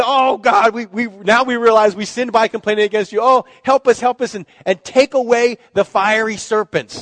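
loud, high begging for help; desperate plea. Retold or imitated. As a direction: plead with God in panic. Israelites begging God for help.

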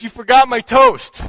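loud, high indignant shout; wife shouting at her forgetful husband, indignant; shouted punchline. A narrator's reading. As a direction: yell it at him, outraged.

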